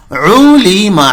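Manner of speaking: عولیما with the ain pressed from the throat; model word ulima. Shown as a demonstration. Teacher models the Quran word for learners. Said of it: "عین ہے یہ عین".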